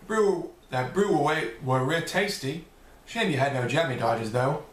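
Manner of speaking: British accent. Shown as a demonstration. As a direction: fumble into a put-on British accent. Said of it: "say something in my best British accent".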